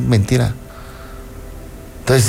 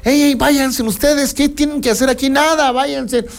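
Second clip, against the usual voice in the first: put-on higher, pushy voice shooing the children away; a bossy disciple chasing the kids off; gruff disciple shooing kids; 11 semitones higher than usual. Disciple shooing the children away from Jesus, higher and pushy.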